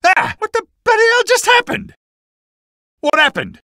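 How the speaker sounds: high-pitched startled outcry; shocked yelling. Acted. Fighter demanding what just happened, shocked cry. Shocked reaction after the attack.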